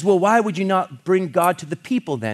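high, loud retold demand; insistent challenge. Savage character questioning the controller, insistent challenge. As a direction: press the question hard, voicing the challenger.

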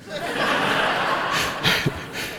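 airy high unvoiced laugh; real amused breathy laugh; breathless laugh. Real, not acted. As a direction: let a silent wheezing laugh out. Laughs at "the big feller.

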